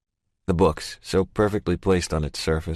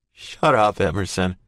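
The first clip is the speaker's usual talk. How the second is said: plain reading voice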